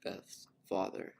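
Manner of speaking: plain reading voice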